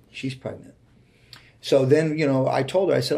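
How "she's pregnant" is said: breathed out almost unvoiced, like a secret; hushed reveal. Real, not acted. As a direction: let the news slip out in a near whisper. Revealing the surprise pregnancy.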